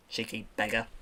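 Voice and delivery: normal talking voice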